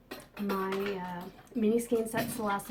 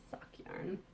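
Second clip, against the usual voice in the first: whispering; 12 dB quieter than usual. Real, not acted. Naming the yarn.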